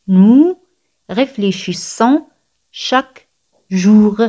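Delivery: "Nous ... réfléchissons ... chaque ... jour", with clear gaps; word-by-word demonstration. Shown as a demonstration. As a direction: pause after "Nous", draw out "chaque jour" slowly. Repeat broken up word by word.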